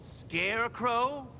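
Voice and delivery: slow, drawn-out rising question; incredulous. Real, not acted. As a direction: stretch the name in disbelief. Reacts to the name.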